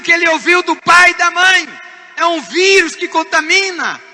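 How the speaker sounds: loud, high, driving sermon voice; fiery pastor preaching; forceful preaching. Real, not acted. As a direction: preach it hard to the congregation.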